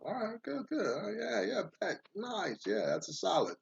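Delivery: high, giddy yes-yes-yes; delighted. Acted. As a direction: squeal with glee at a sweet deal. Woodcutter delighted at the deal.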